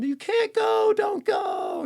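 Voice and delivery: high, loud wailing plea. Retold or imitated. Family wailing at the deathbed, shouting in grief. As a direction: cry out as if begging someone not to die. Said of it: "the wailing".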